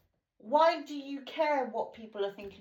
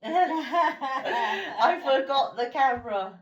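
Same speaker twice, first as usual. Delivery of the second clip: slow, loud laughing repeat; 3 semitones higher, 10 dB louder, much slower than usual. Real, not acted. Telling mum she forgot the camera, laughing loudly. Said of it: "said twice, second laughing".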